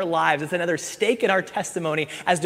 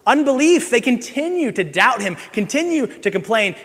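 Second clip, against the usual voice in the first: higher, louder, driving sermon delivery; full-force preaching; 9 semitones higher, 8 dB louder than usual. Acted. Pastor preaching to his church at full force. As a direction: preach it hard to the congregation.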